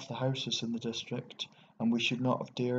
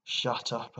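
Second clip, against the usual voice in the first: ordinary delivery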